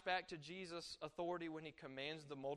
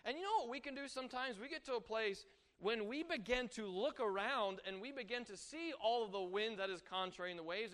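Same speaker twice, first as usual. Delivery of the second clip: ordinary talk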